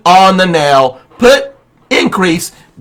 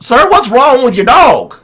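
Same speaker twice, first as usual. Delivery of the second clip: higher, louder mock salesman; salesman's question; 9 semitones higher, 5 dB louder than usual. Salesman at the farmer's door asks about the dog, mild mock alarm. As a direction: voice the startled salesman, lightly.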